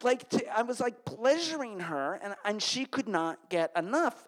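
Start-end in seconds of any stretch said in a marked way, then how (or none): none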